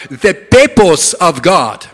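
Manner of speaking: put-on Zambian accent. Zambian friend preaching to puzzled people, Zambian accent. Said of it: "One of my Zambian friends".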